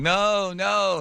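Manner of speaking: high-pitched mock protest; imitating his own frightened no; retold refusal. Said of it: "you see me out there going".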